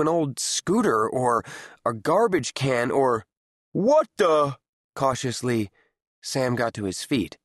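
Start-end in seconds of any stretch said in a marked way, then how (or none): 3.7-4.6 shocked, louder and higher; jolted out of a daze; startled. Acted. Startled by crash.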